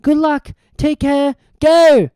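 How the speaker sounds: rising to a loud shout on go; urgent send-off. A narrator's reading. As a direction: push her to hurry. Harry urging her off.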